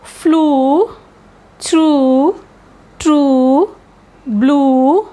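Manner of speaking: "flew, through, true, blue" with the oo drawn out; long U. Shown as a demonstration. Teacher giving long U examples, stretched vowels. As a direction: hold each oo, rising at the end. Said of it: "And long U sound are".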